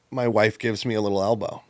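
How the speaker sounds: ordinary talk, nothing marked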